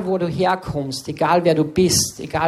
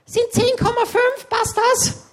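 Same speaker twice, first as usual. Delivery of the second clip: much higher, squeaky sales clerk voice; mimicked clerk. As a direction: squeak it like the overeager deli lady. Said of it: "und dann war's so". (Verkäuferin fragt viel höher und piepsig nach)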